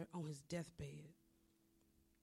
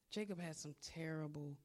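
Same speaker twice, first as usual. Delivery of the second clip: ordinary talk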